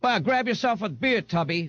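put-on high-pitched tough girl; cheeky beer-girl swagger; brash, rowdy Frida. A narrator's reading. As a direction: taunt him in a high voice. Brash Frida taunts Ignatius.

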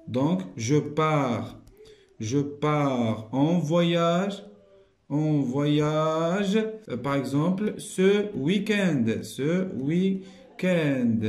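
slow, word by word; drawn-out dictation. Shown as a demonstration. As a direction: dictate the answer slowly. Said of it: "dictating answer".

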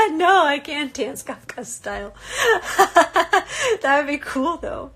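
amused denial through a real laugh. Real, not acted. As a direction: laugh as you turn it down. Laughing at a viewer's question.

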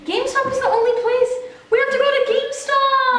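high, loud excited voice. Real, not acted. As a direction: blurt it out thrilled. Realising the answer is GameStop.

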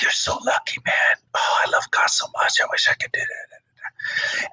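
hushed envious whisper; whispered longing. Retold or imitated. Student whispering to preacher how lucky he is, hushed. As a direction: whisper it like a longing young man. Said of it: "And he's like".

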